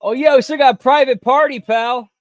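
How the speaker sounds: normal talking voice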